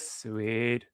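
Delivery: a hint of Cartman savoring the word. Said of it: "that's my best Cartman voice".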